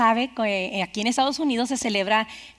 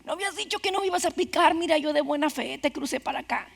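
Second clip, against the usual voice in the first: much softer, fading, wounded voice; dying frog; 9 dB quieter than usual. A narrator's reading. Stung frog asking the scorpion why, weak and dying. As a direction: speak like the life is draining out. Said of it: "moribunda, dice".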